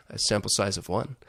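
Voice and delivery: ordinary talk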